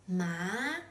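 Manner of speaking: MA rising in pitch; second tone. Shown as a demonstration. Teacher introduces the second tone, MA rising. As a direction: slide MA upward like a question. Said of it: "with a rising tone".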